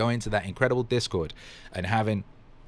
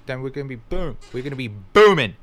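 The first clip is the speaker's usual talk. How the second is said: hype-man surge; rallying shout; 3 semitones higher, 7 dB louder than usual. Real, not acted. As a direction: build to a loud burst on booming. Rallying the audience.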